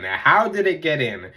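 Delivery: normal talking voice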